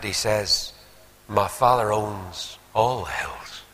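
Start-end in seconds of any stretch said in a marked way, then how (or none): 1.3-3.6 slow, measured correction of oneself; slow right down as the truth dawns; humbled, deliberate retelling, each phrase set apart; sudden realisation. Retold or imitated. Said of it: "suddenly he caught himself on".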